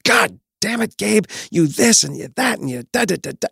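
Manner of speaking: half-hammed-up dad losing his temper at dinnertime; retold angry yell. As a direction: reenact snapping at a six-year-old son, mock fury. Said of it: "And I, and I really yelled".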